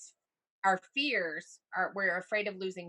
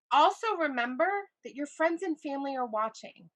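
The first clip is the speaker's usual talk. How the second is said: normal talking voice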